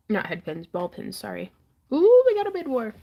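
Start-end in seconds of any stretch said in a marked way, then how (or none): 1.9-2.9 excited. Real, not acted. Auction bids suddenly competing.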